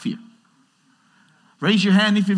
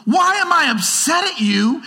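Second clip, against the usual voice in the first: even higher, more outraged echo; indignant girlfriend, rising; 11 semitones higher, 3 dB louder than usual. Retold or imitated. Girlfriend repeating it louder in her mind, higher and outraged. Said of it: "she goes".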